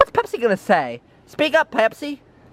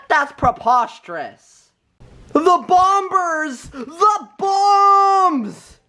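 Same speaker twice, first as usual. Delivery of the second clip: ordinary talk, nothing marked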